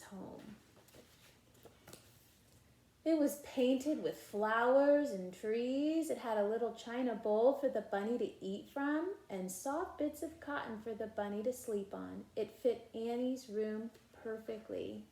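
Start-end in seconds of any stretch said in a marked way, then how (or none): 3.0-15.0 put-on lively read-aloud voice for children; animated storybook reading with character lines; story narration. A narrator's reading. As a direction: read it like a bedtime picture book. Reading picture book aloud.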